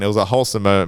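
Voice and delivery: ordinary delivery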